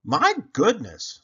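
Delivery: high, loud amazed mother; surprised mother. Acted. Mother marvels at the huge pumpkin, high and amazed. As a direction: gasp it out like a proud mum.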